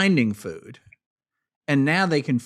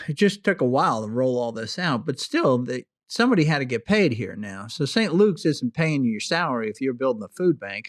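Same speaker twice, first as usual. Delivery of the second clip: normal talking voice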